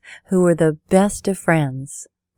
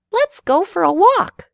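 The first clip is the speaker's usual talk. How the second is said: very high, louder little country mouse voice; bright suggestion; 14 semitones higher, 5 dB louder than usual. A narrator's reading. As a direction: chirp it brightly as an eager mouse. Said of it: "the country mouse brightly suggested".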